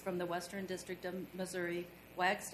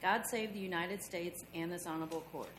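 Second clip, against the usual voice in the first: town crier; 10 semitones higher, 8 dB quieter than usual. Real, not acted. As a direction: proclaim it like a court bailiff. Court crier opens session.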